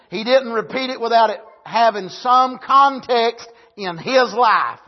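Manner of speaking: loud, building preacher's cadence; preaching at full force. Acted. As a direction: drive each line harder like a revival preacher.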